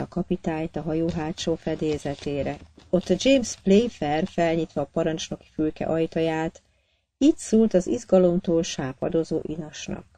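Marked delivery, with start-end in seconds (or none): none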